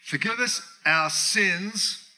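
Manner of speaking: slow, solemn reading of the prayer; slow read-aloud. Shown as a demonstration. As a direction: read it out slowly, like scripture. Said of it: "Let's read this out aloud".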